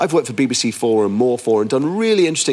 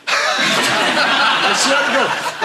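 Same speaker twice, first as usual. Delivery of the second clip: laughing; 9 semitones higher, much slower than usual. Real, not acted. Laughing while swearing it's true.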